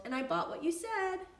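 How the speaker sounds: very high, loud call home. A narrator's reading. Benny calls home to his family, high and loud. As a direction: call out happily from the door. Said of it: "he called".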